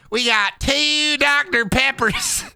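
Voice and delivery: very high, drawn-out Southern voice; slow Southern put-on, breaking into laughs; Southern character. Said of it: "That's my Southern character".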